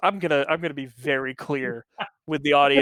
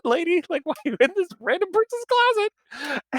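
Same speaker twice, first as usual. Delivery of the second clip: incredulous; 13 semitones higher than usual. Retold or imitated. Incredulous retelling.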